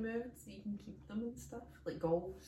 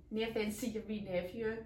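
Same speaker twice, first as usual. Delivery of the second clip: normal talking voice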